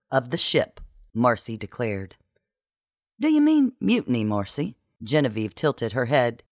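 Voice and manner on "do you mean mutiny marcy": high, quick incredulous question; startled pitch jump, dropping on the name; taken-aback question. A narrator's reading. Genevieve questions Marcy about mutiny, taken aback.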